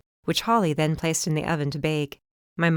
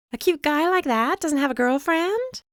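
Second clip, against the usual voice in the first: higher, playful teasing question; 11 semitones higher than usual. A narrator's reading. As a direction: tease her about Joe. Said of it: "Holly teased".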